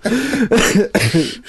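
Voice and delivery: real laugh while sick; laughing through pain. Real, not acted. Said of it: "Miquel, no me hagas reír, que yo también tengo tos".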